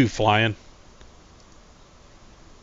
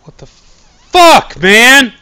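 slow, high, loud drawn-out yell; shocked yell; 12 semitones higher, 18 dB louder, much slower than usual. Real, not acted. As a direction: stretch it out in disbelief. Shocked after the scare.

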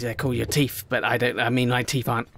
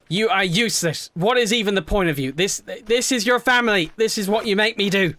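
mock angry rant; 6 semitones higher than usual. Rants at Tina mock-angrily.

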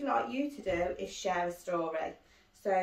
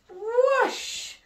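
excited whoosh; 13 semitones higher, 8 dB louder than usual. A narrator's reading. Big moment of the story.

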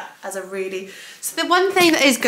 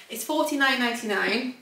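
ordinary delivery

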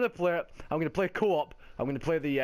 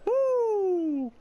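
a high, loud whoop; real cheering whoop; loud woo; 5 dB louder than usual. Real, not acted. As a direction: whoop like a fan. Celebrating in match.

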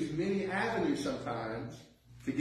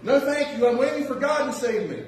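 stubborn refusal; 7 semitones higher, 6 dB louder than usual. Retold or imitated. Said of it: "And the guy said".

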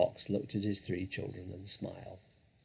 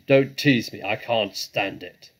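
loud, pleading badger; pained, loud protest; anguished cry; 10 dB louder than usual. A narrator's reading. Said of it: "cried Badger".

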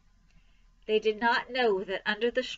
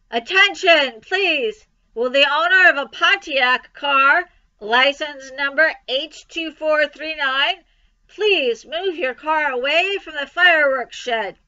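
booming, raised public announcement; enormous loudspeaker voice; 5 semitones higher, 10 dB louder, slower than usual. A narrator's reading. Loudspeaker to the fair crowd, enormous announcing voice. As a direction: announce it loudly across a whole fairground. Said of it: "An enormous voice over the loudspeaker said".

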